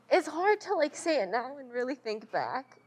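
choked up, pushing through the words; after crying. Real, not acted. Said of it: "I always get teary-eyed about it".

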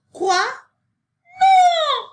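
loud, very high horrified shout; high-pitched dramatic outcry; shocked cry. A narrator's reading. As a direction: cry out, soaring to a long high no. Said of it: "cria Sophie".

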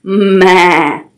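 drawn-out hum into a wavering bleat; goat bleat. Shown as a demonstration. Storyteller shows children what a goat says, bleating. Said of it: "A goat says".

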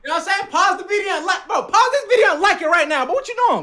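hyped shout. Real, not acted. Hyping viewers to like video.

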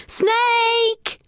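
sudden cry of alarm; shouted warning. A narrator's reading. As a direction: yell the warning back to the others. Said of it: "shouted back at the others".

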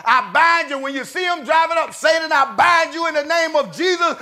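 loud, high shouted rebuke of Satan; preaching at full force. Acted. Preacher shouting down Satan over the house, loud commanding rebuke.

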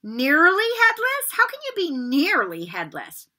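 high, incredulous squeak of a question; can't believe it; astonished boy. A narrator's reading. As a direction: Seamus interrupting the ghost in amazement. Seamus astonished.

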